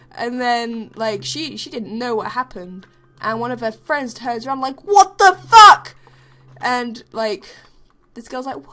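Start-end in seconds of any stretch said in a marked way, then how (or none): 4.8-5.9 high, very loud and slow; outraged yell. Retold or imitated. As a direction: bellow it at the culprit. Friend turns around angry.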